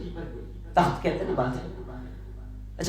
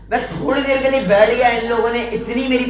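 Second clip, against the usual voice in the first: punchline delivery; 5 semitones higher, 7 dB louder than usual. Acted. Beggar's punchline to king.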